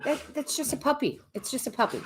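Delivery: high, soft coaxing to the dog; soft high voice calming a puppy; soft puppy talk. Real, not acted. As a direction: soothe the puppy gently.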